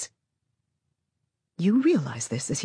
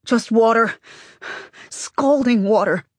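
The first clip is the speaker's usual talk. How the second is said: sharp alarmed cry, then slower; gasping; 4 semitones higher, 4 dB louder, slower than usual. Acted. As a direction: blurt it out in alarm. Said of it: "Lerna gasps".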